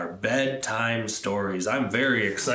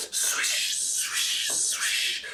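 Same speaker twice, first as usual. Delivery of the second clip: soft fading swishes; whispered leaf sounds; 4 dB quieter, much slower than usual. A narrator's reading. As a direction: hush it like leaves on a roof. Leaves sound.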